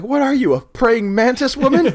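high, loud disbelieving question; mock incredulous outburst. [name] reacting to her handstand, high incredulous yelp.

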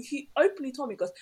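ordinary delivery

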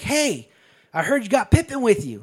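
calling out high across a distance; high-pitched retold holler; mock yell. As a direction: holler it out like a taunt to an enemy. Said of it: "he yells out to the bounty hunter".

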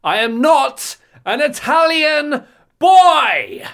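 high, slow, drawn-out bellow; full-volume hammed-up denial; huge indignant shout. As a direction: shout it out word by word, stretching the last. Escalating mock denial on the bus.

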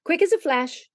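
ordinary delivery, nothing marked

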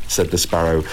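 ordinary delivery, nothing marked

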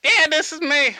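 high-pitched comic character voice; Tony Zamboni. Acted. Tony confirming who he is on the phone, high character voice. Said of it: "is this Mister Zamboni?".

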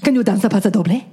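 normal talking voice

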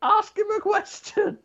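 monkey complaining it can't move its hands; monkey puppet voice. Acted. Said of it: "I don't know where the accent was going".

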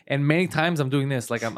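usual speaking voice

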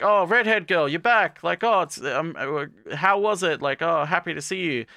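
welcoming her back, chirpy and high; cheery greeting. Retold or imitated. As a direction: greet her like a happy student president. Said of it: "they're like".

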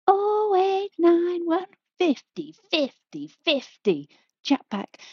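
put-on sing-song the chat line number like an old telly advert. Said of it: "this ad that would go".

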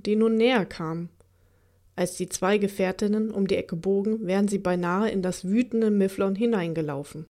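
usual speaking voice

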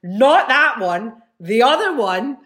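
loud, slow insistent correction. Retold or imitated. Angry mother corrects the dad at the door, loud and slow. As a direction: set him straight, loud and drawn out. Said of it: "the woman went".

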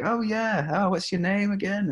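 high-pitched feigned recognition; playing along. Singer covering for not knowing the caller live on air, playing along. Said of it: "have to play along".

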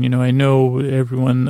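normal talking voice

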